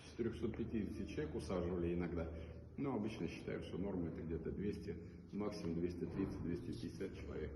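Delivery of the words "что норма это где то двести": normal talking voice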